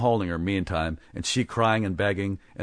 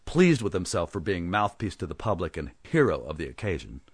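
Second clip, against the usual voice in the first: usual speaking voice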